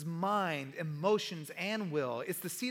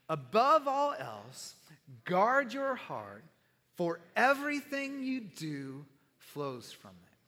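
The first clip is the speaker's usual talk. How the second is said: slow, weighty scripture reading; solemn reading; 3 semitones lower, slower than usual. Real, not acted. Pastor reading the key proverb to the congregation slowly. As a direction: let each phrase land slowly.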